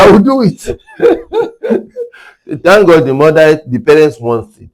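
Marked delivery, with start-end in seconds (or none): 0.0-0.6 laughing. Retold or imitated. Retold grudging compliance, laughing. 0.7-2.6 very high, long falling laugh; high squealing laugh. Real, not acted. Laughs after retold reply.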